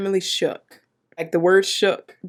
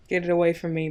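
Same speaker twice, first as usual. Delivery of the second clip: ordinary talk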